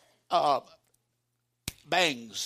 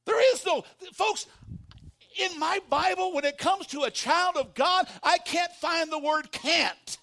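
preaching at full force; 9 semitones higher than usual. Acted. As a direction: drive it home with fire.

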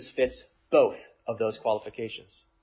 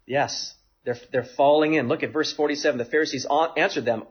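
ordinary delivery

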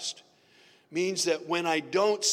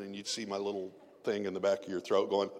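ordinary talk

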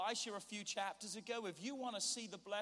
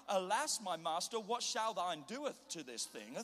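hammed-up archaic Bible recital; mock poetic scripture; 4 dB louder than usual. Preacher mocking how the servant would not talk, theatrical recital. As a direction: declaim it like overblown old-time verse. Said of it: "This is not".

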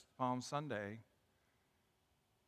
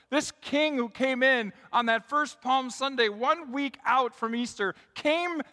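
raised, louder preaching voice; forceful preaching; 6 semitones higher, 9 dB louder than usual. Real, not acted. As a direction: proclaim it to the whole congregation with rising force.

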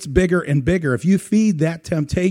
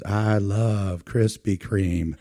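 slow, low Southern drawl; put-on Southern accent, low and lingering; 6 semitones lower, 3 dB quieter, much slower than usual. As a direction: draw it right out like a guy from the South. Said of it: "say it with a Southern accent".

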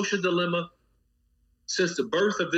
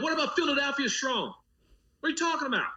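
high, mock-incredulous heckle; incredulous retort; 8 semitones higher, faster than usual. Imitating someone heckling Boston Strong, high incredulous mock retort. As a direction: throw it back in disbelief, pitched high.

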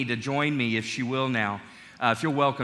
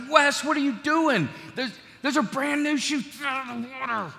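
much higher, panicked dad calling to his son; dad freaking out at his son in the puddle, voice pitched up high; 10 semitones higher than usual. Retold or imitated. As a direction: yelp it like the new shoes are ruined. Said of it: "I start to freak out".

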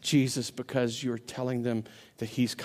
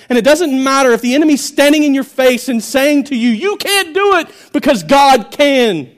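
full-force preaching; 9 semitones higher, 13 dB louder than usual. Acted. Preacher proclaiming God's rescue, full shout. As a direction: preach it at the top of your voice.

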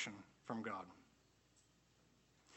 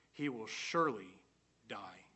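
"surely" slowed, then a long pause and a hushed, much softer "die"; dramatic pause before die; 4 semitones lower, 4 dB quieter than usual. Shown as a demonstration. Preacher warns of death for disobedience, pausing before a hushed die. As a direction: hang on "surely", drop "die" to a low hush. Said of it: "he will die to die".

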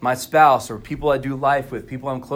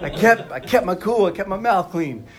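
laughing pride; 8 semitones higher, 3 dB louder than usual. Real, not acted. Proud laughing boast to congregation.

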